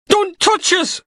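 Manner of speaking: high, slow terrified wail; panicked shriek. Acted. Knox panicking as Logan grabs his sleeve, high terrified wail. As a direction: shrink back in terror and cry it out.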